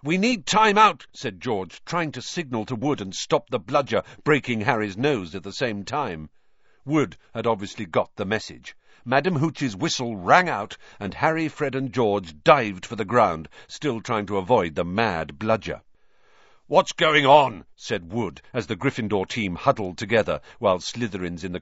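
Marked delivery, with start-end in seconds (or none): 0.0-1.1 high, loud call for a break; urgent shout. A narrator's reading. George urging mid-match. 16.7-17.6 demanding question. A narrator's reading. Wood demanding answers.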